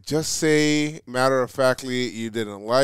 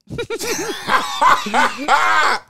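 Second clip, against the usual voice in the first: higher-pitched burst of real laughter; 13 semitones higher than usual. Real, not acted. Cracks up at the quiz answer.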